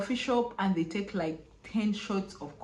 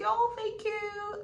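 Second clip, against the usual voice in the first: much higher, slow, sweet thank-you; high polite reply; 14 semitones higher, slower than usual. Retold or imitated. Traveller thanking the officer, high and slow. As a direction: answer sweetly, pitched way up. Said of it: "I was like".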